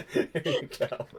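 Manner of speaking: laughing through the words. Real, not acted. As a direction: let the laugh spill in. Laughing with her child.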